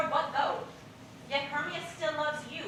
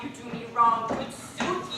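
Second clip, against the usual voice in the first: ordinary talk, nothing marked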